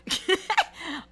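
a short, high burst of real laughter. Real, not acted. Vlogger laughing at her own childbirth joke.

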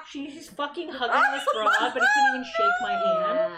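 loud, laughing outrage, rising high; indignant rant. Retold or imitated. Friend fuming that Scott hugged another girl, loud and laughing. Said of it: "Lydia's like".

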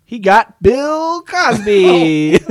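slow, high, loud reveal; drawn-out announcement. Acted. Host reveals to the room which topic John got, drawn-out announcement. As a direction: stretch the name out loudly for the crowd.

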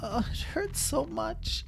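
high, soft, slow hurting voice; pained little voice. Acted. Injured Lila telling friends, soft high pained voice. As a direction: whimper out the pain.

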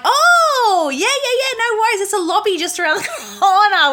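very high, loud, sing-song eager voice; sudden fake friendliness. Guards fawning over the French brother-in-law, mock sweet. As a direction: gush sweetly like a fawning doorman.

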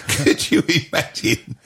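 ordinary talk

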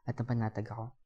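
ordinary delivery

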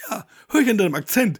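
high, loud mimicked cashier; cashier's retort. Cashier noting the German accent, high and loud. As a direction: pitch it up as the cashier. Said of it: "Und ich dann so".

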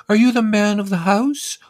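census lady voice. Retold or imitated. Census woman asking the narrator, high prim question. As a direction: ask it sweetly, way up high.

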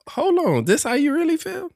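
high, incredulous "hold on, really?"; shocked listener. Retold or imitated. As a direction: play the stunned listener who just heard this. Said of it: "They gonna be like".

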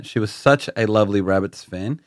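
usual speaking voice

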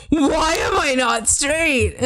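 drawn-out, slow, hammed-up wail; mock anguished cry. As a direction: cry it out like a fake meltdown. Mock breakdown outburst.

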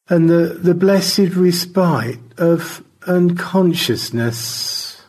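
a lofty high voice, slow and drifting; an old gent fading toward a faint; George. Acted. George tells Stanley he may pass out, lofty high voice.

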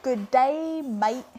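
retry the Aussie greeting; attempted Australian accent. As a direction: put on an amateur Australian accent. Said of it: "Did I sound Australian enough?".

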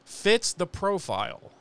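slow "fits the profile" with mock weight; quoted phrase. Narrator quoting Giles with irony.

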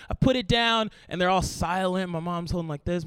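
ordinary talk, nothing marked

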